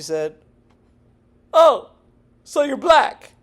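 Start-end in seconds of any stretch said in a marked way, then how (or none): 1.5-3.3 high, loud mimicked question; mock surprised officer. Man mimics officer who asked his race, mock surprise. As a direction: imitate her surprise, pitched way up.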